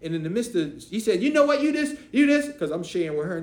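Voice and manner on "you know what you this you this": high, loud teen girl voice mocking him. Retelling the niece scoffing at him, high and loud. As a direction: play the sassy teenager calling him out.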